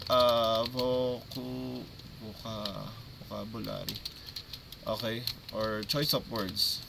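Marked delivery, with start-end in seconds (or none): 0.6-3.9 halting, softer tries at "vocabulary"; sounding out vocabulary. Real, not acted. Coach fixing his own word, sounding out vocabulary. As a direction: feel through "vocabulary" in broken-off attempts. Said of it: "I'm gonna fix our voc".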